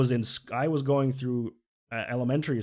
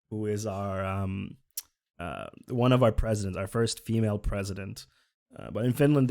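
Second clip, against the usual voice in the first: ordinary talk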